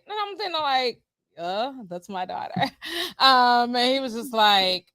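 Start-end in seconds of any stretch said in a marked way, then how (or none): 1.4-2.5 low, soft hesitant answer; retold low-key reply. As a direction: drop your voice for a sheepish retort.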